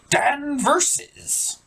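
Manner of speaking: "Dan Versus" pitched far up and drawn out slowly; stretched name. Acted. Pirate stresses show name.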